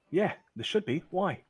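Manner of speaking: high, curious reply; a quick, puzzled answer; lifted woman's voice asking why. Acted.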